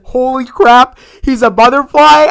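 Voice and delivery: loud, high gasp of discovery; shocked realization. Retold or imitated. Fan reliving the cliffhanger reveal, loud and high. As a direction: blurt it as the twist hits.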